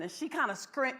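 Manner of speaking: ordinary talk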